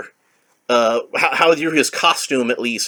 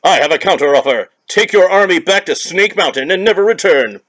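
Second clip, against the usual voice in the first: heroic counteroffer; 5 semitones higher, 5 dB louder than usual. Acted. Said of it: "and he says".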